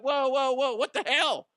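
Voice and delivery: high, startled whoa; alarmed. Retold or imitated. Startled at both voices in his head, high alarmed. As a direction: pull back in shock. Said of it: "I'm like".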